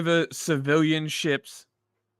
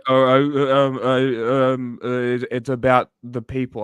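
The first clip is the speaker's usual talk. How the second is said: flustered stammer; 6 dB louder than usual. Retold or imitated. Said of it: "he would have to go".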